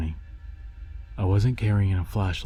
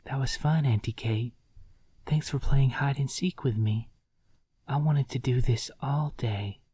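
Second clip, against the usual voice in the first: put-on little girl voice; 5 semitones higher than usual. A narrator's reading. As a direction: play a giggly little child. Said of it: "Julia says".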